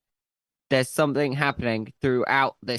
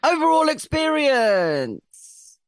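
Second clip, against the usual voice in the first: announcer voice; 12 semitones higher, 5 dB louder, much slower than usual. Acted. Announcing the next section.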